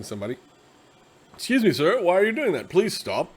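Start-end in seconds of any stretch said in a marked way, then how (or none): 1.3-3.3 polite confronting knight. Acted.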